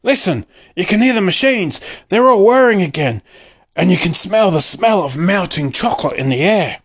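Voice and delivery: high, eager shouts; excited shouting. A narrator's reading. Townspeople hearing the machines again, shouting excitedly. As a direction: urge everyone to listen, thrilled. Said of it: "the people shouted".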